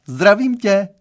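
loud, high, bright-spirited hail; calling out. A narrator's reading. Girlfriend's mother greeting the boy, calling out. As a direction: call the greeting across the room. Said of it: "vyjukla zpoza dveří a zavolala".

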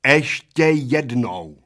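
slow, each syllable chopped off like with a cleaver. Acted. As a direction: hack out every letter at the old men. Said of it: "odsekávala číšnice písmenka jako sekáčkem". (pomalu, slabiku po slabice, jako sekáčkem)

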